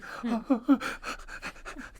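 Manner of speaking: high, breath-catching mock sobs; imitated sobbing gasps. Retelling uncontrollable crying in the car, imitated sobbing gasps. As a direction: gasp in like a bawling child. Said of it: "catching my breath, sobbing".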